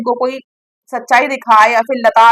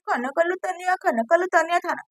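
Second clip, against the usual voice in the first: mock soft coaxing, much softer; imitating housemates gently pleading; 4 semitones higher, 8 dB quieter than usual. Housemates begging Tania to eat, in a soft mock coax. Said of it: "इतना लोग मना रहे हैं".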